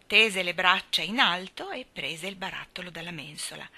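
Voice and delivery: usual speaking voice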